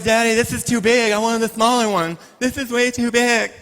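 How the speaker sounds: put-on a little girl whining to daddy; whiny daughter. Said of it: "She goes".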